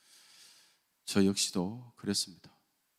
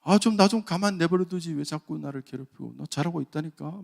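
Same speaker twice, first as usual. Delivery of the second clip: higher, louder whining complaint; retold whiny grumble, pitched up; whiny protest; 5 semitones higher, 5 dB louder than usual. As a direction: fret like someone pestered. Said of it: "막 이러면서".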